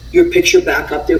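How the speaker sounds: ordinary delivery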